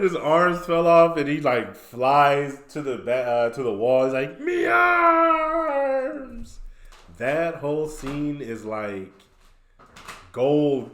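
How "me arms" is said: high, loud cartoon cry; drawn-out mock scream; mock anguished wail. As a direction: wail it out long and high, imitating Mister Krabs. Retold Mister Krabs wailing for arms.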